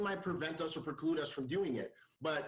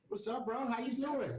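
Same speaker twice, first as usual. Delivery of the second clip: excited greeting; 7 semitones higher than usual. Real, not acted. Greeting the arriving guest.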